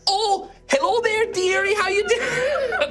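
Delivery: very high, slow dearie voice; high sweet old lady. Acted. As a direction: coo it like a sweet old shopkeeper. GM voices cow lady NPC.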